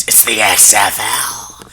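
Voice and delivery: slow, drawn-out promoter growl; Vince McMahon. Acted. Answering co-host about the league, in a Vince McMahon impression. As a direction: stretch out the letters like a wrestling boss's announcement.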